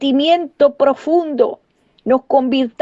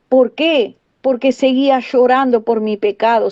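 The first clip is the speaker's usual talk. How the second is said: ordinary delivery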